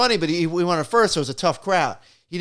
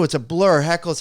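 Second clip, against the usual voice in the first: ordinary talk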